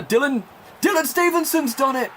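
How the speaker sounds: high, rising goal shout from the commentary box; excited goal call. Acted. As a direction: scream it like the winner just went in.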